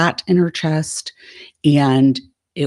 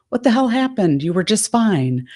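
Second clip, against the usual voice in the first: high-pitched bewildered question, lightly voiced; a hint of the boyfriend's baffled surprise; retold puzzled boyfriend; 7 semitones higher than usual. Boyfriend confused by sudden mood.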